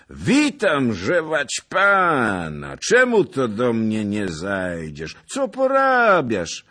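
put-on loud, high, slurred drunken hail; drunken nobleman greeting. A narrator's reading. Drunk Łaszcz loudly hails Skrzetuski, slurring high. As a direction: bellow a tipsy welcome.

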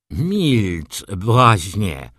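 loud, garbled scolding from a wounded baron; mumbled rebuke. A narrator's reading. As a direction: blurt it thickly through a hurt mouth. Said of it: "wybełkotał baron". (bełkotliwa, głośna reprymenda)

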